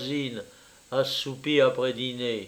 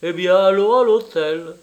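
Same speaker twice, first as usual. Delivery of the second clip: loud, higher, mocking retort; irritated; 5 semitones higher, 9 dB louder than usual. Traveller quotes the woman's answer to his friend, irritated. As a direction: imitate her irritation at full voice. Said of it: "du ton le plus irrité".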